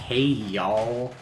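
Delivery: put-on cowboy accent. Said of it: "dresses up like a cowboy and says".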